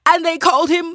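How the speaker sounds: high, loud wailing voice; crying wife. Acted. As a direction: wail it out, high and tearful. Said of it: "He started crying too".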